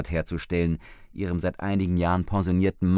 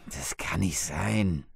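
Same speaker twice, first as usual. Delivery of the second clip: husband's dismissive whisper; 4 dB quieter, slower than usual. Acted. Husband's standard disbelieving answer.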